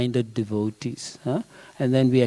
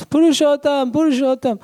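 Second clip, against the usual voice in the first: much higher and louder, calling across the house; shouting for the servant; 12 semitones higher, 5 dB louder than usual. Retold or imitated. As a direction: yell the name down the hall for help. She shouted for.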